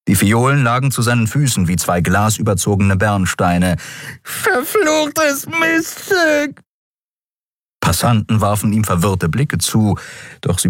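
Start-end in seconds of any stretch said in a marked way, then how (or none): 4.2-6.6 very high, stretched-out howl of pain; yowling. A narrator's reading. Dealer curses Andriel in the snow, yowling. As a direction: wail the curse slowly, high and injured. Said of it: "jaulte er".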